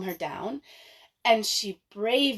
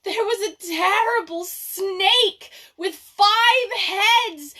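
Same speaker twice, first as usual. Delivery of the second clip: much higher, louder, hysterical sobbing plea; crying, screaming; 10 semitones higher, 6 dB louder than usual. Acted. Manyara begging her father to stop her sister, hysterical screaming. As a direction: scream and beg through tears. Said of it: "she cried and she cried and she said".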